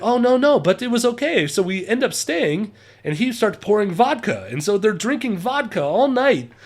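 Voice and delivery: hammed-up German-accented English; terrible German accent. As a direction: imitate an earnest German storyteller. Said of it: "my terrible German accent".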